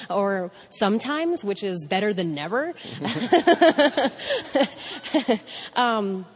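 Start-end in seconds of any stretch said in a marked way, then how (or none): 2.8-5.7 big laugh. Real, not acted. Laughing at her own joke.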